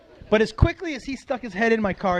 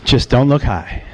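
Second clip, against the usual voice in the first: low, forceful warning to himself; self-coaching inner voice; 12 semitones lower, 8 dB louder than usual. Acted. Stoner coaching himself at a police stop, low and emphatic. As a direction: drop it low and punch it out. His tense inner voice while pulled over.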